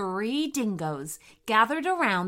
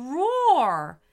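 loud, higher storytelling climax; dramatic emphasis; 6 semitones higher, 6 dB louder than usual. A narrator's reading. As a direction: boom out the word as a climax. Narrator dramatises the roar.

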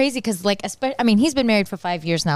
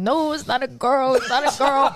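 imitating sobbing brother; 5 semitones higher than usual. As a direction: drag it out like a tearful brother. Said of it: "like, sobbing".

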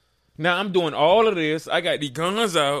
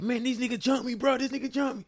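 higher, imitating the beat-up brother; hurt brother; 10 semitones higher, 4 dB quieter than usual. Retelling brother who got jumped, higher mock whine.

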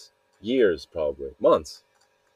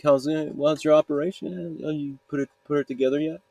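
high, mocking monster voice, higher than usual; 6 semitones higher than usual. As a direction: mimic the pestering creature in a raised, teasing voice. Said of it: "this fiend over here who keeps coming by".

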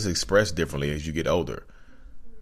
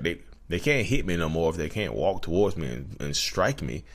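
normal talking voice

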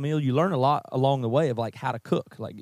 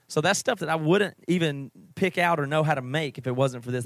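ordinary talk, nothing marked

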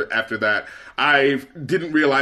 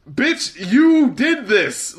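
incredulous scolding; 7 semitones higher, much slower than usual. Real, not acted. To the accused celebrity, a slow high incredulous call-out. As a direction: spell out the blame slowly. Outraged at the statement.

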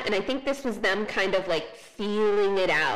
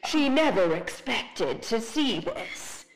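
slow trailer narrator voice; movie trailer voice; slower than usual. Retold or imitated. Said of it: "the voice dropping into being like".

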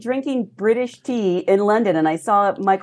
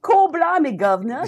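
hammed-up Cockney accent, pitched up; mock Cockney accent; 6 semitones higher than usual. Shown as a demonstration. Asked about her accent after London, mock Cockney accent. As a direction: play a cartoon Cockney accent. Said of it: "Do you have no accent now".

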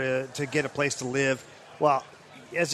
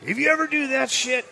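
coach's threat; 6 semitones higher than usual. Retold or imitated. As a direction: threaten him like a furious coach, slowing on the threat. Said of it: "He goes".

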